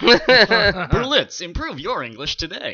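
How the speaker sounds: hamming up a commercial sign-off; ad tagline. Retold or imitated.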